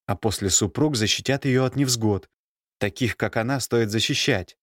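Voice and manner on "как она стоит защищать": ordinary delivery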